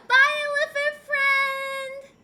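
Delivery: high, slow sing-song goodbye; silly goodbye. Pretend. Streamer bids elephant friend goodbye, high drawn-out sing-song. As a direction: stretch it out like a cartoon farewell. Playful sign-off voice.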